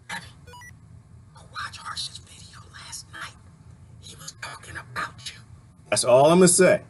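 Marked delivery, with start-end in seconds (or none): 0.1-5.4 barely audible, voiceless whisper; hushed mock-confidential tip-off; secretive whisper. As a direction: whisper it like a secret aside to Debbie. Hushes others, confides to Debbie.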